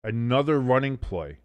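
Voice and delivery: ordinary talk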